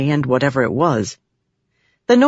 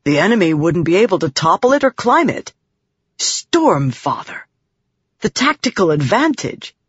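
excited; 3 semitones higher than usual. A narrator's reading. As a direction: marvel at a tactical advantage. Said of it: "seemed to get Adolin excited".

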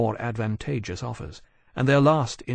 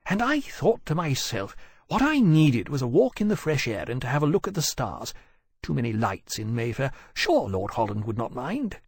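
airy gentleman; 2 semitones higher than usual. Acted. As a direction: toss off excuses like a tipsy gentleman. Voices Wolvey's airy excuses.

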